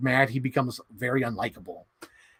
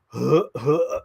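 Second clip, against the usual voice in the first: two quick fake retches; lightly acted retching pair; mock gagging. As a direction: gag twice like you're about to throw up. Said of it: "two people going".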